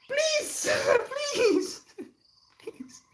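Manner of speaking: very high squeaky begging; high-pitched, half-laughing plea; squealed pleading. Real, not acted. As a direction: beg a puppy through a laugh. Begging puppy, breaking into laughter.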